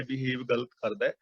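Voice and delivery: usual speaking voice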